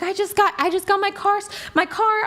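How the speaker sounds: high, breathless panicked stammer. Retold or imitated. She tells her boyfriend the car is gone, high and panicked. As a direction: blurt it out in shock, barely able to talk.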